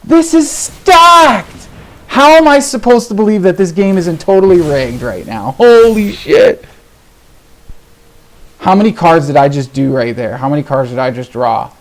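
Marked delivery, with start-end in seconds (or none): 0.0-6.6 high, loud yelling in outrage; furious outburst. Real, not acted. Player accusing the game of being rigged, furious outburst. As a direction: yell it high and drawn out, incensed.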